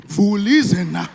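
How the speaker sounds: loud, slow, drawn-out moan of pleasure; hammed-up climax moan; mock climax cry. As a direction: wail it out like she's coming. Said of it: "She was like".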